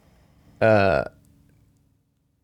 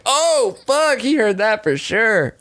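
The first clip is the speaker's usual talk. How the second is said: alarmed realisation; 8 semitones higher, slower than usual. Retold or imitated.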